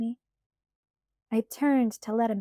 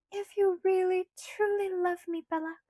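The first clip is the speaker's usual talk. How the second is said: put-on light, higher girlish pleading voice; Alice's voice; 9 semitones higher than usual. A narrator's reading. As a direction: voice Alice higher, coaxing Bella. Alice coaxing.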